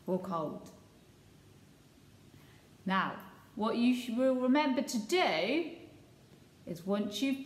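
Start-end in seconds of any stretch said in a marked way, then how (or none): none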